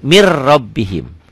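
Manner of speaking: "mir rabbihim" with no N at all; silent N, doubled R. Shown as a demonstration. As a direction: roll straight from "mir" into "rabbihim". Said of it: "huruf matinya jangan dibaca".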